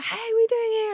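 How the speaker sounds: mimicking him, pitched far up. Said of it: "And he was like".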